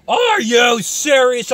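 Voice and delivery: slow, high shout of disbelief; incredulous shout. Real, not acted. Shouting in disbelief at the doom guy, slow and high. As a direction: stretch it out in a fed-up shout.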